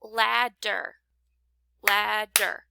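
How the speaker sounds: "Ladder, ladder" each broken into lad and der; two separate beats on each "ladder"; clapped-out syllables. Shown as a demonstration. Teacher repeating for pupils, each "ladder" in two separated beats. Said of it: "If I clapped out".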